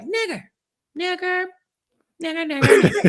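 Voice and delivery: high, loud taunting chant; mimicking schoolkids who shout the word; mocking kids. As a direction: imitate kids yelling it gleefully. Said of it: "they was just like".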